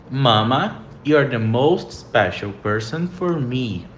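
the sentence said slowly a third time; slow model sentence. Shown as a demonstration. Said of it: "Próxima frase". (frase modelo lenta)

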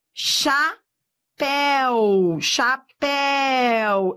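CHAPÉU as cha, péééu; drawn-out syllables. Shown as a demonstration. Teacher showing the diphthong in chapéu, drawn-out syllables. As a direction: split chapéu and stretch the éu glide.

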